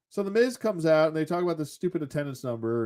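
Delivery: normal talking voice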